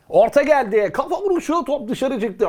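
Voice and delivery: ape a shouting commentator; mocking football commentator. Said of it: "papağan işi bu".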